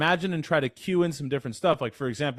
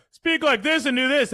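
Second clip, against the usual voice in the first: much higher and louder, pushed; overdone chest voice; 13 semitones higher, 4 dB louder than usual. Shown as a demonstration. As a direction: push too much chest up into a strained call. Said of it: "Whoa, dude, that sounds weird".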